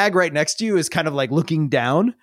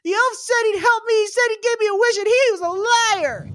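put-on high child's voice, close to tears; a boy wailing that the elf lied; lightly voiced sobbing kid; crying child. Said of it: "and just cries miserably".

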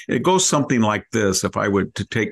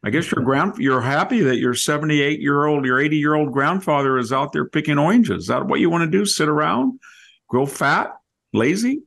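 mock scolding dad; 7 semitones higher than usual. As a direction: scold lazy teenage sons like a fed-up father. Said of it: "my dad would say".

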